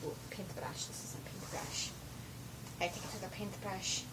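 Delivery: hushed secret whisper. Acted. Showing the paintbrush, a soft whisper. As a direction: breathe it like a secret.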